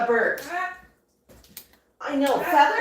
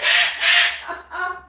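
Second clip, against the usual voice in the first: short laugh; 4 semitones higher, 4 dB louder than usual. Real, not acted. Joke about wedding.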